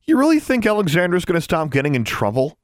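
high-pitched mocking amazement; acted incredulous amusement, pitched high; guffawing disbelief. A narrator's reading. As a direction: hoot the question in amused disbelief. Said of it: "he guffawed".

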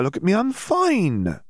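high, slow, dreamy protest; woozy protest. Acted. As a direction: drift through it like she's floating. Dazed Hermione insists.

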